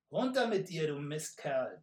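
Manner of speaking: high, loud, spat-out insult; hissed threat. Acted. Archer hisses threats at the messenger. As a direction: snarl at the rider with contempt. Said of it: "zischte der Bogenschütze".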